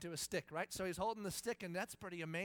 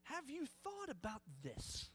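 slow, soft, higher and careful; strategic question; 5 semitones higher, 6 dB quieter, slower than usual. Shown as a demonstration. Husband gently steering his wife with a question. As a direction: ease the question in gently, slowly. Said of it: "so you ask a strategic question".

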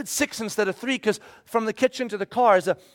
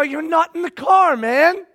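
higher, louder rebuke; scolding call; 7 semitones higher, 4 dB louder than usual. Retold or imitated. Pastor scolding his kid for missing the car, louder.

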